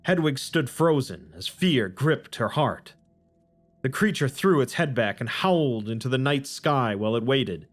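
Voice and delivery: measured, solemn storytelling read; story narration. A narrator's reading. Narrator reading scripture to the audience, solemn storytelling. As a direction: tell it like old scripture to listeners.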